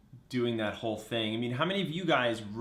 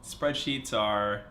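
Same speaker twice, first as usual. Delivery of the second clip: very slow, savouring each word; slow drawn out; much slower than usual. Pretend. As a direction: stretch spreadsheets out playfully. Teasing co-host about spreadsheets.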